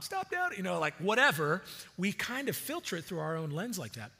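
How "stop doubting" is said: high shrill-free nagging mom voice, pitched way up; a hammed-up scolding mother; mock scolding mom. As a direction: imitate a mother telling you off. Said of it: "sounds a lot like my mom".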